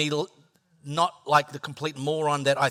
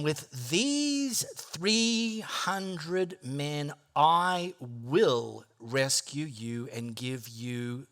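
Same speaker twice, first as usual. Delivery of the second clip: solemn scripture; 2 semitones lower, 4 dB quieter, much slower than usual. Acted. Quoting the Lord solemnly.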